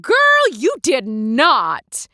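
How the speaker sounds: slow, loud, scandalized drawl; shocked gasp. Acted. Kenval reacts to Darmonda calling the captain an oaf, scandalized. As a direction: stretch it out in disbelief.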